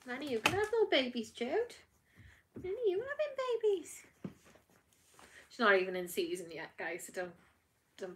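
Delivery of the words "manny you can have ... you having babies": high, fast teasing coo; playful pet voice, pitched well up. Real, not acted. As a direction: tease the dog up high. Teasing the other dog.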